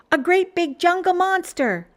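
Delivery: Sarah exclaiming at a caterpillar, high and loud; excited exclaim. Acted. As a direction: play up a pretend monster. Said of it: "Sarah exclaimed".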